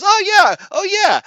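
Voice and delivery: very high, loud, squeaky "oh yeah"; squeaky mock worker. Worker assures the students, squeaky and high. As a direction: chirp it way up high like a cartoon.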